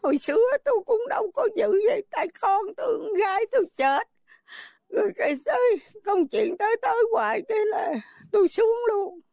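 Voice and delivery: grieving mother speaking through tears; real crying. Real, not acted. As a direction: fight back sobs while telling it. Grieving mother recalls daughter's death.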